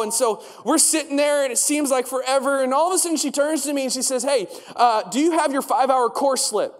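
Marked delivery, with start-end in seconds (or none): none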